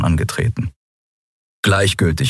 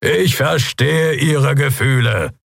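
slow, raised-pitch public address; unshaken guard captain, placating; 8 semitones higher, slower than usual. A narrator's reading. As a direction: hold firm while calling to a crowd. (langsame, unerschütterliche Hauptmannsstimme)

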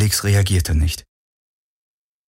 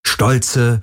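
usual speaking voice

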